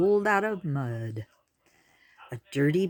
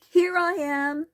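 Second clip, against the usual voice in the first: high called-out reply; yelling back; 13 semitones higher, 3 dB louder than usual. A narrator's reading. The turtle yells back to Tor, high and called out. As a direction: call back across the mud. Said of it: "yelled back".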